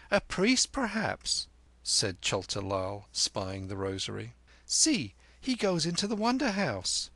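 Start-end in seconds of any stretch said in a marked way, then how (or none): 0.1-1.5 put-on high-pitched small boy; high, piping child; little boy Chota Lal. Narrator voices little Chota Lal. 4.6-7.1 put-on high-pitched small boy; high, eager little boy; little boy Chota Lal. Narrator voices little Chota Lal.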